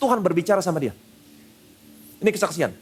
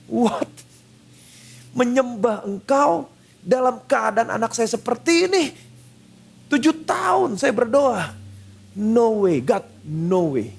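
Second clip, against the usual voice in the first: angry protest; 3 semitones higher, slower than usual. Retold or imitated. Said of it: "Dia makin marah".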